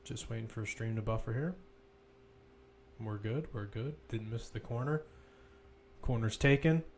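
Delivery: ordinary delivery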